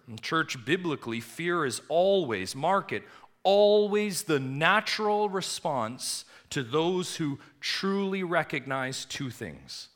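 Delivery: loud, high preaching build; thundering pulpit voice; fiery preaching. Acted. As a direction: preach it with rising fire. Preaching at full force.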